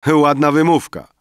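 high, loud retort; exclaiming. A narrator's reading. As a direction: exclaim it back at your friend. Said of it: "wykrzyknął poeta". (głośny, wysoki okrzyk poety)

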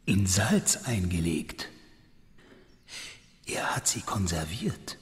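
slow, appalled whisper; horrified, breathless murmur. Acted. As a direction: hush the words as if at a grave. Examining the body, whispered.

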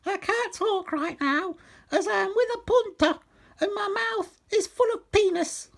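very high falsetto phone answer; woman character. A narrator's reading. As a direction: put on a high comic woman's voice. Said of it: "this is what she said".

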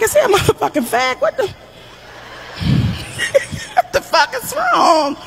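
laughing in disbelief at her own son; bursting into laughter mid-question; incredulous laughing outburst. Retold or imitated. Mother laughing at her gentle son. Mom mocks son's hobbies, laughing.

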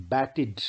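"batted" in two syllables, ending "id". Shown as a demonstration. Teacher shows the rule on batted, ending pronounced "id". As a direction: sound the -ed of "batted" as "id". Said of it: "आखिरी ईडी को इड बोला जाता है".

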